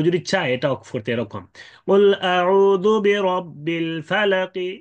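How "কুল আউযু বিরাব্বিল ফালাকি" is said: slow, higher chant ending "ফালাকি" with a light i; rum stop recitation. Shown as a demonstration. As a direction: recite slowly, sounding the final kasra in "ফালাকি". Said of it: "জেরটা হালকা উচ্চারণ করে". (রুম করে ধীর তিলাওয়াত, "ফালাকি"-তে হালকা জের)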